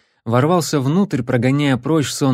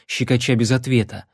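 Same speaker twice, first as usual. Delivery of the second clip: plain reading voice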